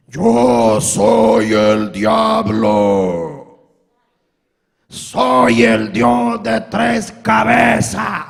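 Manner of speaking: slow, loud demonic double voice; voice from beyond the grave. Retold or imitated. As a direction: drag out each word like a devil on the phone. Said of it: "con voz de ultratumba". (voz de ultratumba lenta y demoníaca)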